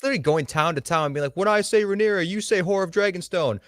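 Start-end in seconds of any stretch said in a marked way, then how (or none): 1.4-3.6 high-pitched call to a crowd; hammed-up crowd-rousing instruction; mock rally leader. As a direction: imitate a hype man setting up a call-and-response. Imitates Criston leading a town crowd chant.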